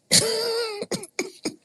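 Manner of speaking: a shrill falsetto cackle of mockery; high mocking laugh. Real, not acted. Mocking the emotional caller.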